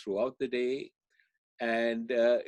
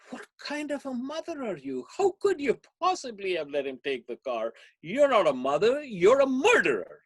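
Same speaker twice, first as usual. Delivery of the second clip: high, loud accusing outburst; accusing friend; 13 semitones higher, 6 dB louder than usual. Retold or imitated. Friend scolding the mother after the accident, high and loud. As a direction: hurl the blame at her, high and loud. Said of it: "And the friend says".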